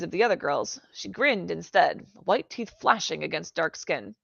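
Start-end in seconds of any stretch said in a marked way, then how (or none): none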